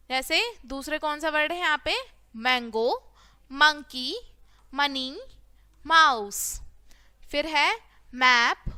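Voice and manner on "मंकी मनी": normal talking voice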